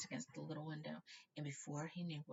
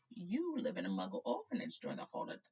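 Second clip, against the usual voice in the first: higher, curious frail voice; curious old man; 8 semitones higher, 5 dB louder than usual. Acted. Dippet asking about the orphanage, curious and high. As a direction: ask like a curious old wizard. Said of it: "said Dippet curiously".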